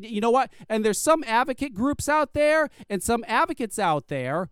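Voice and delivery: usual speaking voice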